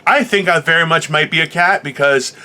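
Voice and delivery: ordinary talk, nothing marked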